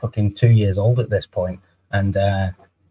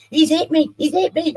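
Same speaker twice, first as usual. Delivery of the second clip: mock shouting. Man retells ex falsely crying out he hit her, high mock shriek. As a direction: shriek it up high like a fake victim. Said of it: "she starts fucking shouting".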